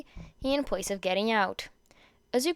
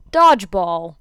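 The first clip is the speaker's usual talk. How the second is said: announcing loudly; 3 semitones lower, 10 dB louder, slower than usual. Acted. Teacher announces the game.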